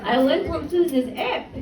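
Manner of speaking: put-on drawn-out French-accented friend. French friend tells about her love life, French accent. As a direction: play a French woman, taking it slowly. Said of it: "in a French accent".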